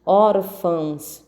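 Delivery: "Órfãos" slow, ÓR-fãos; slow teaching "Órfãos", stress on ÓR; stressed first syllable. Shown as a demonstration. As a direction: hold the first syllable of "Órfãos", then drop. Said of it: "a sílaba tônica é o or".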